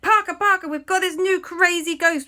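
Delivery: high, excited acted alarm; urgent plea. Friends ringing Parker about ghost, urgent pleading. Panicked phone call for help.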